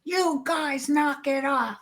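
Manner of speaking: put-on reliving yelling at the kids to stop; retold screaming at bullies. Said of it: "I started screaming".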